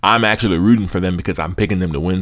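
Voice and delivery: ordinary delivery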